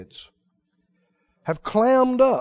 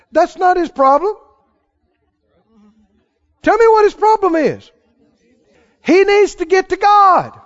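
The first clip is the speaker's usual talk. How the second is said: loud, rising preacher's voice at full force; fiery preaching; 6 semitones higher, 6 dB louder than usual. Acted. Preacher condemning hypocrites to his congregation, loud and fervent. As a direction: drive the point home to the congregation.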